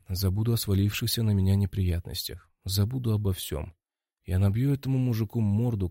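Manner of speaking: ordinary delivery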